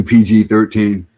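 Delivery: usual speaking voice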